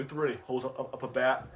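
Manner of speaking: ordinary talk, nothing marked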